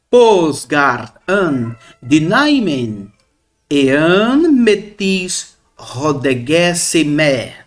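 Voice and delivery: very slow, deliberate Greek reading; Greek recitation. Shown as a demonstration. Teacher reading the Greek verse to students, slowly. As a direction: stretch out every Greek word.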